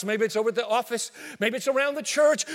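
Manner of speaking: usual speaking voice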